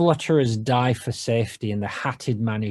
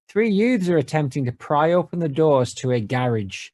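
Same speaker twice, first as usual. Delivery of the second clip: normal talking voice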